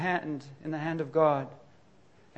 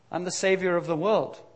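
ordinary talk

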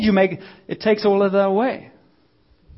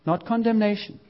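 ordinary delivery

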